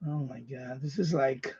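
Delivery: ordinary talk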